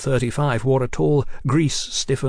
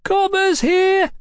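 drawn-out, very high street-seller's cry; slow, piercing call to the whole street; screeched; 5 dB louder, slower than usual. A narrator's reading. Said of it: "he screeched like a man selling hot chestnuts".

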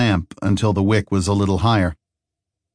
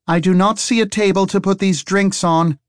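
raised voice over a crowd; shouting across a room; 11 semitones higher than usual. A narrator's reading. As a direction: call it over the room's noise. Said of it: "Harry shouted across the room".

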